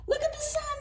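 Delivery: very high excited call; high squeaky owl voice. Acted. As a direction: share the sun breathlessly high. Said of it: "said Oliver".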